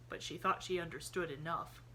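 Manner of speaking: usual speaking voice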